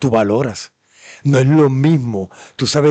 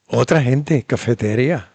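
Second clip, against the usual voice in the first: ordinary talk, nothing marked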